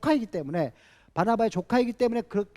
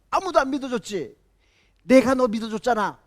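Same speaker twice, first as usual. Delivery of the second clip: Barnabas's thought bursting out louder; the swallowed words start to push out; rising indignation; 5 semitones higher, 7 dB louder than usual. Retold or imitated. Barnabas thinking back at the shouting Paul, rising heat. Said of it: "그 말이 여기까지 막 이렇게 나왔을".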